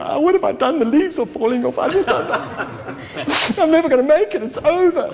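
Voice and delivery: high, far-off wailing lament, half laughing; despairing tree. Pretend. A tree in autumn laments its leaves, hammed-up high wail.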